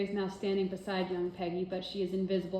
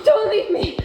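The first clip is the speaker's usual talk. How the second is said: loud, slow, high cry; desperate plea; 15 dB louder than usual. Acted. Girl screaming after her father in a nightmare. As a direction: call after him as he leaves.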